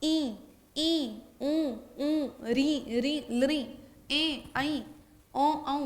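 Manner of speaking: vowels drawn out slowly with a nasal ring; nasal vowel demo. Shown as a demonstration. Teacher to students, slowly demonstrating nasalized vowels. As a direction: sound each vowel through the nose, slowly. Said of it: "इसका उच्चारण जब करोगे कंठ का भी प्रयोग".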